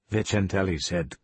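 usual speaking voice

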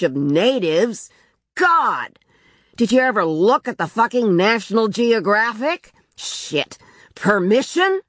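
exasperated tirade, pitched high; irate husband raising his voice; angry shouting. A narrator's reading. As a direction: rant in scornful disbelief. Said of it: "when he started shouting".

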